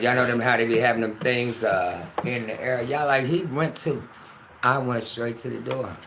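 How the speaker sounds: usual speaking voice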